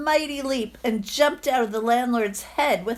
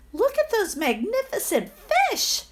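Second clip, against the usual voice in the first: higher, laughing wonder; delighted cry; 11 semitones higher than usual. Acted. Tabo sees carp in the new pond, excited laughing cry. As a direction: marvel at the fish with joy. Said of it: "cried Tabo".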